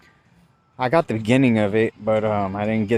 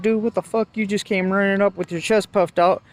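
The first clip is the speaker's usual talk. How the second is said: indignant, high-pitched retelling; retold cussing out; 9 semitones higher than usual. Driver answering a cop who rushed up, retold indignation. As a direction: relive snapping back at an officer, pitch up. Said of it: "I ended up cussing this one out".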